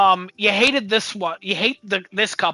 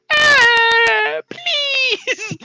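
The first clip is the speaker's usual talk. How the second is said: very high, louder mock sob on please; crying elephant; 4 dB louder than usual. The elephant begging the parents to stop, high mock sob. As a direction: beg through fake tears like a small toy.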